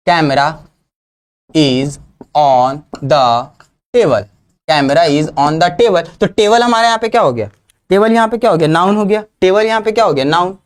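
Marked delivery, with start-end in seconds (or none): 0.1-6.2 slow, lower, word by word; slow example sentence. Shown as a demonstration. Teacher giving an example sentence slowly to the class. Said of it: "जैसे एक example".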